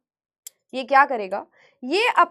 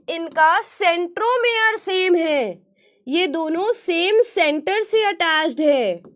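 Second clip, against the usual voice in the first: slow explanation; slower than usual. Shown as a demonstration. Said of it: "teacher slows to explain".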